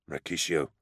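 numb and emotionless; dead voice. A narrator's reading. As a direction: say it with nothing left inside. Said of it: "he said in a dead voice".

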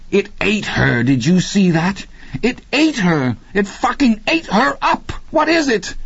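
rising into near hysteria, pitch shooting up; panicked. Acted. Deke to Randy after the creature eats Rachel, panicked and shrill.

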